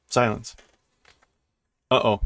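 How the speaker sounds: usual speaking voice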